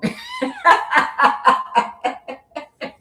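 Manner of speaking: high-pitched cackling laugh. Real, not acted. Reader laughs at their own parent joke, laughing. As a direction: let out a long, high run of laughter.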